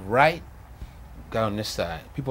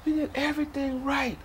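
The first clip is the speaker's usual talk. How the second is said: high but softer, sinking despair; quieter anguished repeat; 4 dB quieter than usual. Retold or imitated. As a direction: let the protest drain away. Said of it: "I'm hollering".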